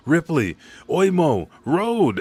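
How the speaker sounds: imitated yelling. Giants calling to fallen compatriots, yelling. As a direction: call out to fallen comrades, pitched high. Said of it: "as they are yelling".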